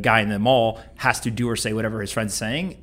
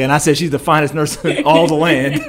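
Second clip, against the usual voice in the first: laughing; 4 semitones higher, 7 dB louder than usual. Retold or imitated. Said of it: "And he's like".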